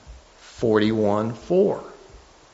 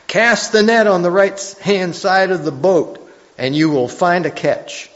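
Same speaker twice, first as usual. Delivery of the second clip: loud, raised call to the boat; 7 semitones higher, 6 dB louder than usual. Acted. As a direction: call it out high and loud across the water. Jesus calling to fishermen, quoted.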